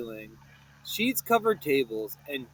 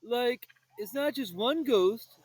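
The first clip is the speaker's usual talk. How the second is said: moaned; 6 semitones higher than usual. Acted.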